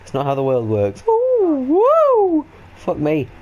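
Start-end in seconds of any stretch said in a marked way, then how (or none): 0.0-1.0 mock offended. Hurt complaint to suspicious guard. 1.1-1.8 high loud ooh of surprise; startled squeal. Real, not acted.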